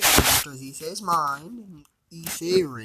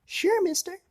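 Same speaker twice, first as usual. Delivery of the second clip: put-on much higher, small child voice; eager little girl answering a stranger; little kid voice. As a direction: chirp it like a young girl. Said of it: "the kid said".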